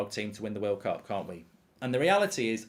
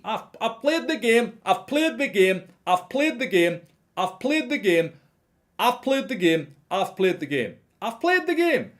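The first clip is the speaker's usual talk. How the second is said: high, loud mocking rant, stress on played; mock a pundit ranting into his mic; ranting Souness impression; 10 semitones higher, 6 dB louder than usual. Vlogger mocking a ranting pundit, high loud rant. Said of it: "he's ranting with a".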